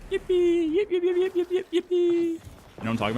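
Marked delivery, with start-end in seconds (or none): 0.1-0.7 tiny squeaky yippee, far higher; yappy chihuahua voice. Retold or imitated. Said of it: "that voice line". 0.7-2.4 rapid squeaky yips, far higher; yappy chihuahua voice. Retold or imitated. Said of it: "that voice line".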